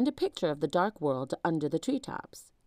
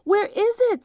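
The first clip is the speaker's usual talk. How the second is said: higher, louder frantic question; frantic, searching; panicked; 8 dB louder than usual. A narrator's reading. Fleeing army ants.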